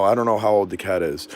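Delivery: usual speaking voice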